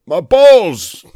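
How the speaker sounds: loud high yelp of pain. Retold or imitated. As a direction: howl it like a hit villain. Mock pained villain.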